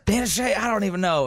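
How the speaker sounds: high-pitched mock impression; Scott Hendricks. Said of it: "That's kind of our Scott Hendricks".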